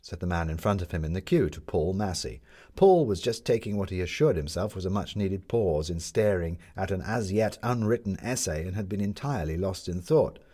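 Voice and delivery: ordinary delivery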